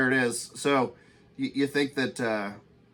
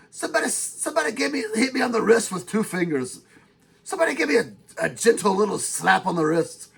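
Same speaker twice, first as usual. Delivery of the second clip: usual speaking voice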